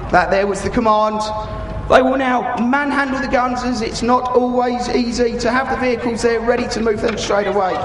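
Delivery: ordinary talk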